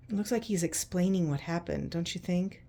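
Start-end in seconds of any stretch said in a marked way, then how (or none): none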